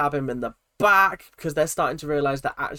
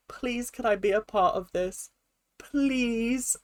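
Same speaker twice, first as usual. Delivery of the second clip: imitated plea, higher with a drawn-out please; high little begging voice, retold; pleading; 6 semitones higher, slower than usual. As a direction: beg sweetly to be let in, mimicking her. Retelling a housemate's plea to join.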